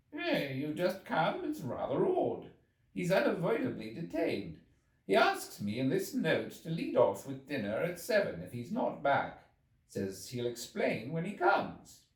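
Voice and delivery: a mild puzzled doctor reading out a note; medical man. A narrator's reading. Medical man explaining the host's note to the narrator, mildly puzzled.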